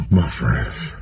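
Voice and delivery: breathless from laughing; gasping, out of breath after laughing. Real, not acted. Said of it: "I'm out of breath".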